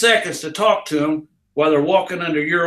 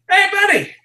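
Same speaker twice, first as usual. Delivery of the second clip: carnival yell. Retold or imitated. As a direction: holler to grab attention from far off. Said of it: "I would scream at 'em".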